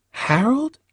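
put-on high, questioning girl's voice; high-pitched surprised call; Cindy calling out.

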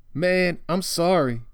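put-on high-pitched, uneasy boy's voice; squirming apology. Boy squirming before Bones, uneasy apology. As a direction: apologise while itching to get away.

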